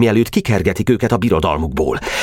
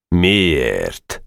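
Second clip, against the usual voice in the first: Janó's voice. A narrator's reading. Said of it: "ismét hallatszott a tót hangja".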